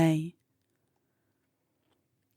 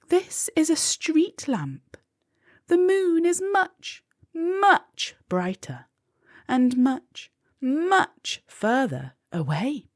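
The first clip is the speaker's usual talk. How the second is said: much higher, fussy spider voice; 9 semitones higher than usual. A narrator's reading. The spider telling Molly the moon is too far, in a higher fussy voice.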